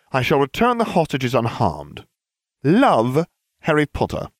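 lightly voiced pompous letter; Harry's letter voice. A narrator's reading. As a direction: read it with polite menace.

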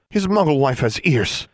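high, indignant comeback; sharp retort. A narrator's reading. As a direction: retort as a slighted wife. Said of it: "Missus Yuan retorted".

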